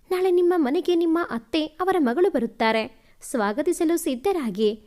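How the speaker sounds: usual speaking voice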